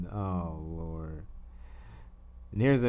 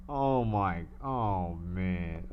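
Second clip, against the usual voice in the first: usual speaking voice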